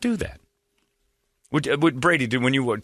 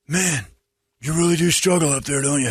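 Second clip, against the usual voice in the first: higher, louder put-on busybody voice; mocking colleague impression; 6 semitones higher, 3 dB louder than usual. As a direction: imitate a needling, tactless colleague. Mocking retelling of Jeremy's remark.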